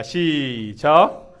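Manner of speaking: long drawn-out cue, rising at the end; calling the start. Real, not acted. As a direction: stretch it out to cue the congregation. 회중에게 읽기 시작 신호. (길게 늘여 읽기 시작을 알리는 구령)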